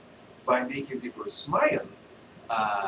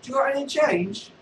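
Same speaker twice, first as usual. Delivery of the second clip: high pleading beggar voice; street beggar; 11 semitones higher, 4 dB louder than usual. Acted. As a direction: beg for change in a high voice. Beggar asks Vader for change.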